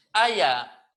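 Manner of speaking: "aya" launched by a popped ejective K; loud glottal K click before "aya"; explosive K. Shown as a demonstration. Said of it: "Una K explosiva".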